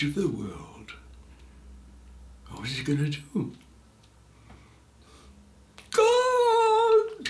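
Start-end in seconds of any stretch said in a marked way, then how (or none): 5.9-7.3 high, loud call into emptiness; shouting to God. A narrator's reading. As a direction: call out to the sky. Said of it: "he shouted".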